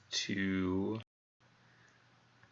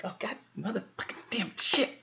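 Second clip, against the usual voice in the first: high, exasperated swearing burst; frustrated cursing; 12 semitones higher than usual. Real, not acted. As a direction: curse at the bad roll. Player groans at bad roll.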